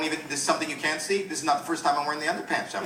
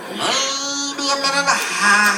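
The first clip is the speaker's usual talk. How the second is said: high, squeezed helium-victim complaint; worried patient stuck on helium; squeaky, raised voice explaining an embarrassing condition; 6 semitones higher, 4 dB louder than usual. Acted. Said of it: "with the helium voice".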